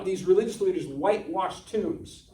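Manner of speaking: ordinary talk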